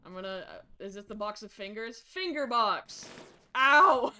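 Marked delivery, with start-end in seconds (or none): 3.6-4.1 loud sharp ow; loud pained ow; sudden yelp. Real, not acted. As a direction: yelp as if hit. Sudden hurt.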